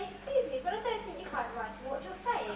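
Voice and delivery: prim model of good manners; polite, ladylike correction. Shown as a demonstration. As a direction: demonstrate how a lady would object. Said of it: "You should say".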